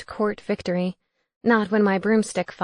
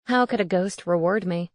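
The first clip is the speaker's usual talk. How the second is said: usual speaking voice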